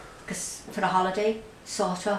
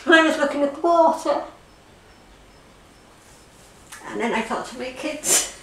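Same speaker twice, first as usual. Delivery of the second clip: tearful, breaking down; 6 semitones higher than usual. Real, not acted. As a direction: break down while telling it, with long halting pauses. Breaks down retelling her flight.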